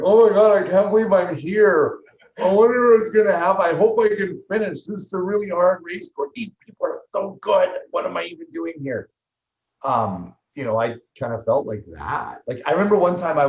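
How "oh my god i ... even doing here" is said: put-on high, loud, wide-eyed kid voice; little kid. Rookie at the World Championships, sounding like an awed little kid. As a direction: gush like an awestruck child at his first big race. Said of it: "I was like this little kid going".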